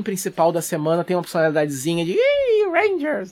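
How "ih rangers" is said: high, hammy villain taunt; Sentai monster voice. Retold or imitated. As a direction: sneer like a cartoon monster. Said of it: "Essa imitação".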